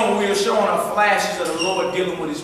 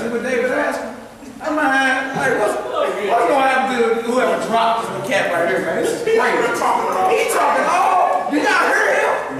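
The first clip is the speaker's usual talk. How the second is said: excited; 5 semitones higher than usual. Retold or imitated. Excited retelling of David's question.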